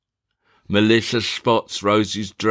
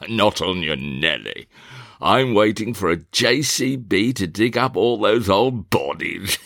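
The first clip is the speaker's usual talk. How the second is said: gruff landowner. Acted. Fenton muses over the meadow as a blunt country squire. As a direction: play the bluff father scornful of his son.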